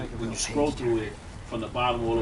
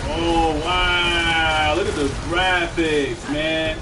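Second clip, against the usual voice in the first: drawn-out, louder wow of admiration; amazed; 2 semitones higher, 5 dB louder, much slower than usual. Real, not acted. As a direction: marvel out loud at the game. Marvelling at game graphics.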